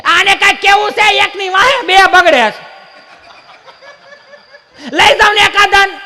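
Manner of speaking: put-on high, loud, slow complaining old woman; exasperated grandma. Worn-out grandma complaining to grandpa about the kids. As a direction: wail at him like a fed-up grandmother.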